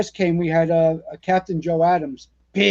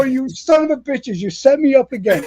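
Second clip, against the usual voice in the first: higher, laughing indignation; mock outrage; 6 semitones higher than usual. Rookie catching on to the prank, higher mock outrage. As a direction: cry foul at the pranksters.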